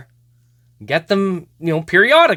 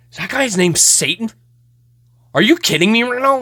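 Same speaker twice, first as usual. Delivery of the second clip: retold earnest disbelief, pitched up; mock-serious friend, higher voice; imitating a serious friend; 8 semitones higher, 3 dB louder than usual. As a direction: play a friend's serious, incredulous questioning. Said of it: "it's all serious".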